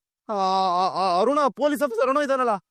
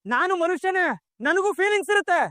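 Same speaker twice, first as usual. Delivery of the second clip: higher, pained outburst; grieving son's angry cry; anguished anger; 9 semitones higher than usual. Acted. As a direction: let the grief burst out. Grieving son lashing out.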